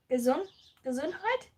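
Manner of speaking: "Gesundheit" restarted, then said whole with a questioning rise; second careful go at "Gesundheit"; 1 retrying Gesundheit. Shown as a demonstration. Host retries the German word, unsure. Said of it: "We say".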